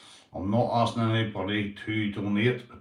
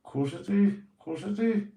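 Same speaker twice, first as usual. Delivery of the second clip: much higher, cheeky put-on voice; mock silly voice; 12 semitones higher than usual. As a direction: play the pet talking back, pitched way up. Answers in a put-on voice.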